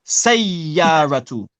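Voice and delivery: Sayyaratu drawn out very slowly, doubled ya; slow model of Sayyaratu for the student. Shown as a demonstration. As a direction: stretch each sound of Sayyaratu. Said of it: "you gotta give the ya".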